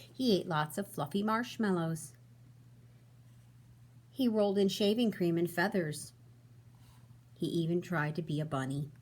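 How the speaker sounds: storybook read-aloud, expressive and even; reading to children. A narrator's reading. Teacher reading to her class, storybook voice. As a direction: read it like bedtime story time.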